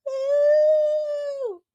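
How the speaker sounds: long, high-pitched "ooh"; high drawn-out ooh. Real, not acted. Host showing off her sparkly mug, high cooing ooh. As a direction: coo one long high note.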